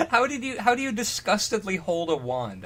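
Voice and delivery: ordinary talk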